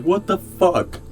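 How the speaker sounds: high-pitched, loud incredulous protest; outraged disbelief. Retold or imitated. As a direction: act out exasperated disbelief, pitch jumping up. Acts out outrage at goblin wedding.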